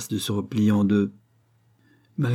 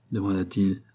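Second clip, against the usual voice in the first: ordinary delivery, nothing marked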